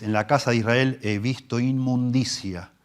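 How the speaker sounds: slow, weighty Bible reading; solemn scripture reading. Acted. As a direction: read the psalm with gravity. Reading the prophet aloud.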